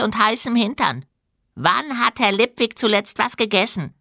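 high, squeaky goblin voice. A narrator's reading. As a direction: chatter like an eager little goblin. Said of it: "Der Goblin reichte Feucht".

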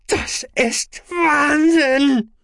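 high, slow, loud scream. A narrator's reading. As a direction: shriek it in outrage. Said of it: "schrie Aspartamin".

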